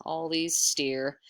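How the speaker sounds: plain reading voice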